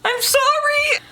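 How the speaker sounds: squeaky high little sorry; a tiny, very high apology; mock sorry. Apologizing to angry dad.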